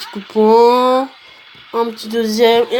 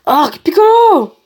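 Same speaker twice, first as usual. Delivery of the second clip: drawn-out, louder high shout; dramatic cry; 5 semitones higher, 6 dB louder, slower than usual. Acted. Player voices a game character's lines, dramatic cry. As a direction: call out in acted alarm, stretching it. Acted dialogue, crying out a name.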